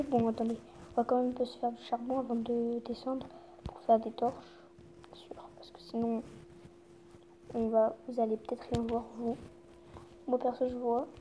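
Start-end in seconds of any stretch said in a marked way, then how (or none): 5.1-6.2 very soft unvoiced whisper. Real, not acted. Telling viewers about torches in a whisper. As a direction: drop to a hush mid-sentence. Whispered aside while playing.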